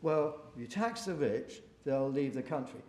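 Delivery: ordinary talk, nothing marked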